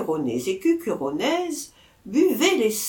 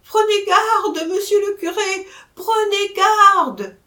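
alarmed cry; 11 semitones higher, 8 dB louder than usual. A narrator's reading. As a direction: cry out to warn the priest, aghast. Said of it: "s'écria".

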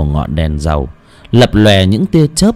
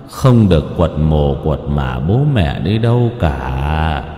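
distant ghostly voice; 6 semitones lower, 3 dB quieter, slower than usual. Acted. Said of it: "vọng về từ một cõi xa xăm lắm".

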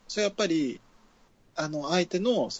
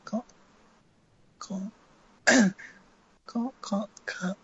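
higher pitched try; 6 semitones higher than usual. Shown as a demonstration. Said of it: "キー上げましょう".

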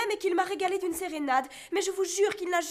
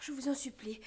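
whispered plea; 4 semitones lower, 7 dB quieter than usual. Acted. Daughter pleads.

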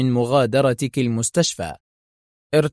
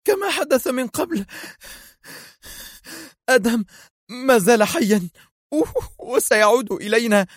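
high, fierce insistence; woman insisting her husband still lives; sharp cries; 10 semitones higher than usual. A narrator's reading. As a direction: fight back, backing away. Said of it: "صرخت وهي تتراجع في حدة".

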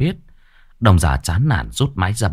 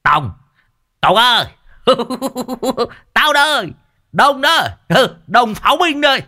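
excited calling out. A narrator's reading. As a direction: shout his name across the road, overjoyed. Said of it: "Lão vội vàng đá cửa xe kêu lên".

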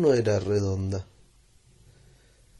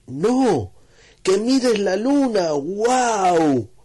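much higher, louder, slow urging; excited insistence; 10 semitones higher, 6 dB louder, much slower than usual. Acted. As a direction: insist he look, thrilled. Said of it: "Anastasio le dijo".

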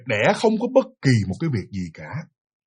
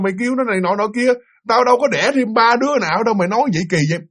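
raised, heated voice scolding the son; angry scolding father; 5 semitones higher than usual. Retold or imitated. Grandfather scolding his eldest son for lying, angry raised voice. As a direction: berate him like a furious father.

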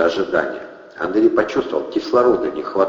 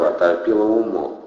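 plain reading voice

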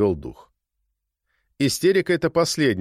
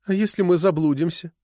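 trembling voice; 6 semitones higher than usual. A narrator's reading. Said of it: "Дрогнувшим голосом, в котором звучали слёзы".

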